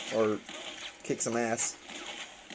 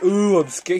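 mock scared; 5 semitones higher, 5 dB louder, slower than usual. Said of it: "He's like".

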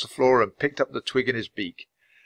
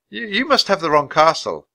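put-on high, louder boy's bluff; boy bluffing a villain in a higher voice; nervous boy; 7 semitones higher, 5 dB louder than usual. A narrator's reading. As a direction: stammer the first word, then insist. Frightened Jack bluffing the king.

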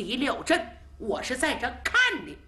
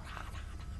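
hoofbeats; 16 dB quieter, faster than usual. Acted. Horse hooves imitated.

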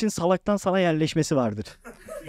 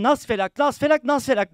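frantic prayer; 7 semitones higher than usual. Retold or imitated. Reciting a prayer in fear.

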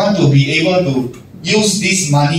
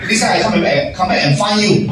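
higher, rushed pretend excuse with a laugh; hurried brush-off; 6 semitones higher, faster than usual. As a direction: act busy and leave in a hurry. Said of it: "Who are you?".

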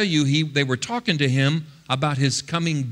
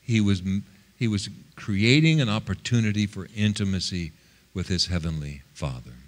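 usual speaking voice

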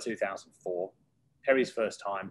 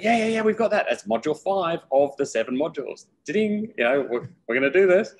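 high, loud, rushed brushing off; breezy eager pitch; 8 semitones higher, 7 dB louder than usual. Retold or imitated. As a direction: wave away the worry with gusto. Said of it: "I'm like".

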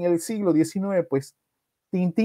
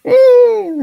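loud high infant wail; baby wail imitation; 8 dB louder than usual. Narrator imitates baby wailing, loud high cry. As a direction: let out a baby's loud wail. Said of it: "Bueno, esa es mi, mi versión".

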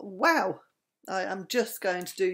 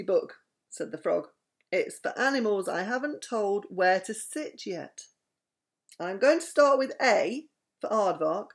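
lively read-aloud with voiced animals; bedtime story reading; 2 semitones higher, 7 dB louder, slower than usual. A narrator's reading.

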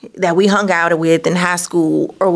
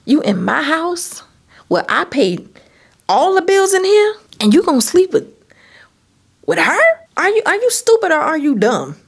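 furious confrontation; 6 semitones higher than usual. Retold or imitated. Retelling confronting the cheating boyfriend, furious scolding.

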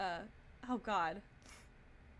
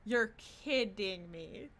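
stunned disbelief; 2 semitones lower, much slower than usual. Real, not acted. Disbelief at character's name.

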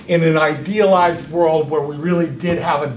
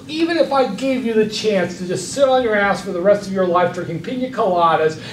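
usual speaking voice

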